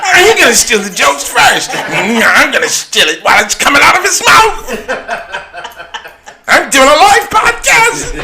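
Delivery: very high, loud cartoonish voices; joke-stealing comedians. Joke thieves racing to steal material, high frantic voices. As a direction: squeal frantically at your rival. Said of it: "comedians that all bite jokes".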